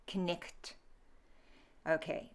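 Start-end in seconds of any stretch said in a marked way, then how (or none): 0.1-0.7 "knicht" as k-nikht, every letter sounded; phlegmy GH. Shown as a demonstration. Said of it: "I gave a phlegmy sound".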